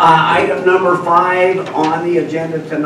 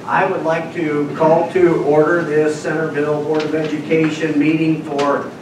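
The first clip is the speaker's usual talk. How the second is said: ordinary talk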